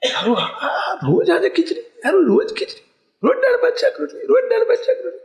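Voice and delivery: whiny mock complaint. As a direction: whine like a kid who is sick of the same dinner every night. Mocking the bored daily-food complaint.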